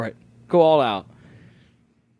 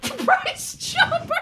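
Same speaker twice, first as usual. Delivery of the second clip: high, drawn-out laughing disbelief; helpless laughter; much slower than usual. Real, not acted. Co-host caught off guard by the workplace, helpless laughter. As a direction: squeak the words out through real laughter. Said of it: "I shouldn't laugh at that".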